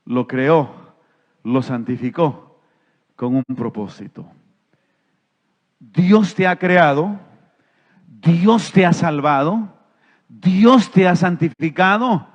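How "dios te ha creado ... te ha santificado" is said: ordinary talk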